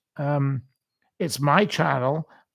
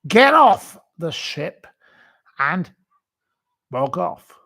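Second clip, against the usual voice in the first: angry shout; 3 semitones higher, 6 dB louder than usual. Real, not acted. Ranting at a hated person.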